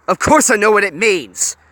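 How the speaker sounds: loud, high spat retort. A narrator's reading. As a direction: spit it back at her. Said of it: "Honey spat".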